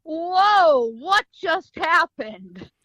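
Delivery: slow, stretched-out whoa; stunned amazement. Retold or imitated. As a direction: draw every word out, dumbfounded. Stunned after the gunfight.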